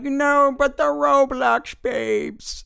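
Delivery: put-on high, loud whining boy; crying kid voice. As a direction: wail it slowly like a sobbing kid.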